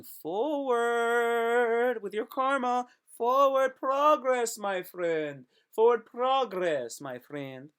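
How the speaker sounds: stretched, high and loud rallying call; hammed-up exhortation. Rallying a friend to work through karma, hammed-up exhortation. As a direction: draw the words out big and high.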